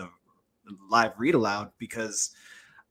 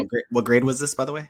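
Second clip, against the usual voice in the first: repeated louder so the host hears; 2 semitones higher, 6 dB louder, faster than usual. Real, not acted. Host repeating a missed question to the guest, louder. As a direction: raise the voice to repeat the question clearly.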